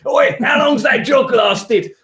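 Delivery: high, slow put-on shout of a cop; officious copper. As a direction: bark it out like a nosy officer. Acted jogger stopped by officer.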